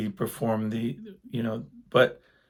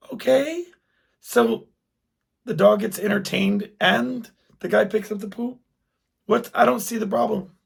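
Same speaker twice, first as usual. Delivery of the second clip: much higher, baffled disbelief; incredulous husband; 10 semitones higher, 3 dB louder than usual. Retold or imitated. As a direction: ask it like it makes no sense at all. Husband baffled, retold exchange.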